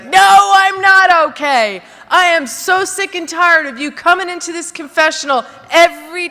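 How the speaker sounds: a priest at his wits' end; exasperated scolding. Retold or imitated. Priest scolds her in confession.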